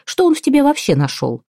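very high outraged question; acted high-voiced outrage; indignant. A narrator's reading. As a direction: pitch it right up in indignation. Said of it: "возмутилась Ляля".

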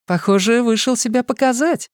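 put-on playful young woman gossiping; bubbly sorority girl. A narrator's reading. Fortuna teasing about dog owner. (игривая девушка)

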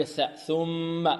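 slow الثاء ثم with interdental ث; tongue tip out for ث; clear tha. Shown as a demonstration. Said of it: "من طرف اللسان".

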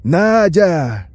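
loud, drawn-out desperate call; hoarse screaming. A narrator's reading. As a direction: bellow the name hoarsely through a door. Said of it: "хрипло вопит Ефимов".

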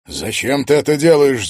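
bitter, pleading reproach; bitter question. A narrator's reading. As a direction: ask it with bitterness. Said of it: "с горечью спросил Егор".

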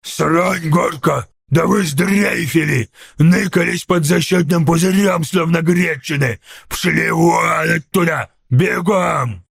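drawn-out put-on ork tirade; angry ork yelling. A narrator's reading. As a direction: chew out cowards in an ork voice. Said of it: "заорал Сниггоп".

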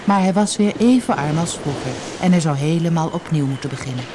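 usual speaking voice

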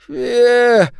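very high, loud ghoul sound; a shrieked, inhuman syllable; eldritch horror. A narrator's reading. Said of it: "говорит голодный".

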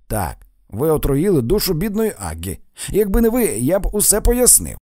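loud, high indignant accusation; acted outraged outburst; angry accusation. A narrator's reading. Accusing her of poisoning Aggie.

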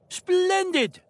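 high, loud joyful exclamation; delighted cry. Acted. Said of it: "the doctor cried".

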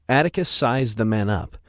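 ordinary delivery, nothing marked

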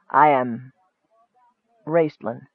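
low, halting self-introduction; hesitant wizard. A narrator's reading. Wizard pained by the question, halting and low. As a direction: pause midway and let the name drop softly. Wizard reluctantly gives his name.

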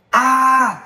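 high piercing scream of pain; burning scream. Acted. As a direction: scream as bones snap. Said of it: "Tiếng gào thảm thiết vang thấu trời xanh". (tiếng gào thảm thiết)

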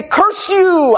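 hammed-up villain cry, high and loud; old-timey curse. Back in the day someone might shout this, hammed-up loud. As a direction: shake a fist and bellow it like an old melodrama villain. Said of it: "they might even say".

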